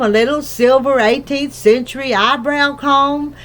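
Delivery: ordinary delivery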